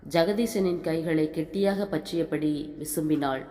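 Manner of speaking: usual speaking voice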